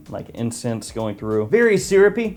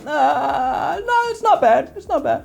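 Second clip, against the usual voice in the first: high, hesitant "it's not bad" voice, clearly put on; a hammed-up shrug of a verdict, unconvinced; lukewarm reaction voice. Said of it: "It's kinda like".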